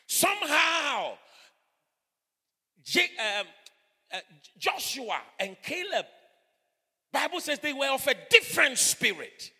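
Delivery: loud, high pulpit proclamation; full-force preaching. Acted. Preacher proclaiming Joshua and Caleb's different spirit, full-force preaching. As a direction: belt it out to the congregation.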